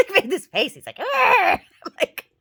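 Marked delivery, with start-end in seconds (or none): 1.0-1.6 very high, loud imitated "ah"; exaggerated imitation of an affronted squawk; mocking screech. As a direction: squeal it in mock outrage. Imitating neighbor's noise again.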